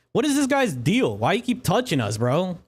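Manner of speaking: high, indignant complaint; indignant npc. Acted. Npc whining at the stranger who keeps touching them. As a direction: whine at the stranger grabbing you.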